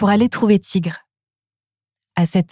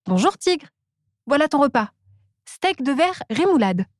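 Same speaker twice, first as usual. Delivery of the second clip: Tortoise's voice; 6 semitones higher, 3 dB quieter than usual. Acted. Tortoise brings lunch.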